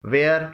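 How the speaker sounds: ویئر said as "wair", h silent; correct pronunciation. Shown as a demonstration. Teacher models the correct ویئر, h silent. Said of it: "ان تمام الفاظ کی صحیح pronunciation ہے".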